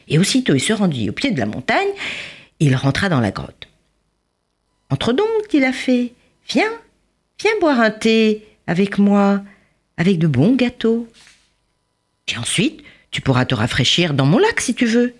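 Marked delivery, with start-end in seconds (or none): 4.9-15.1 fairy welcome. A narrator's reading. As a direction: lure him in sweetly. Fairy invites Chang.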